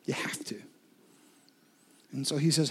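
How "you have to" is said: soft, lower unvoiced whisper; whispered echo of the exhortation to the congregation. Real, not acted. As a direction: repeat it as a hushed whisper. Whispered repeat of previous line.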